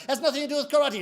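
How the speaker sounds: high, insistent contradiction; complaining student. Acted. Student arguing with his sensei, high insistent protest. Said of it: "he complains to Sensei".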